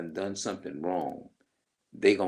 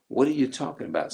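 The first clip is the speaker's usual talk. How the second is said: quick, higher mock innocence; mocking high fast denial; 7 semitones higher, 4 dB louder, faster than usual. Mimicking someone caught out, rushed high denial. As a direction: rattle it off high like a dodging liar. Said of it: "when people say".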